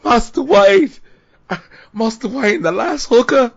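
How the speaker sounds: high, loud hammy butler voice; hammed-up Michael Caine. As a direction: ham up a weepy old British butler. Michael Caine impression skit.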